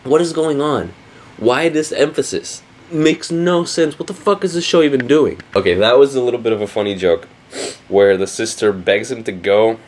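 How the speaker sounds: ordinary talk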